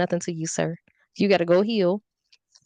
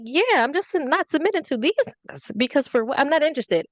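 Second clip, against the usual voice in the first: sassy retort; 6 semitones higher than usual. Retold or imitated. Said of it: "I'm like".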